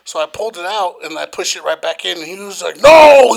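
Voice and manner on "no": long, high, loud mock scream; retold angry shout. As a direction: holler it as the outraged customer. Retells customer's angry outburst.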